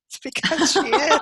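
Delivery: high, laughing through the punchline; a laugh breaking through the retort. Retold or imitated. To people who assume, a laughing retort.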